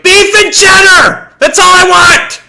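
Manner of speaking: high, loud, slow shouted order; angry shout. Retold or imitated. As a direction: bellow the order at the dazed cashier. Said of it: "all of a sudden, he's like".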